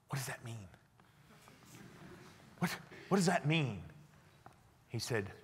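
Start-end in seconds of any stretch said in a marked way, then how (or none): 0.1-0.7 breathless whisper of disbelief; stunned whisper. Retold or imitated. Stunned by the driver's reveal. 2.6-3.8 higher, stumbling repeat out of disbelief; surprised repeat. Retold or imitated. Repeats the question, voice returning.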